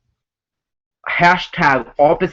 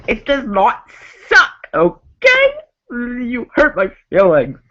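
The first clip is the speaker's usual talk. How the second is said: very high, squeaky fake-hurt voice; mock hurt squeak; 9 semitones higher than usual. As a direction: whine it like a wounded cartoon. Mock hurt reply to a fan.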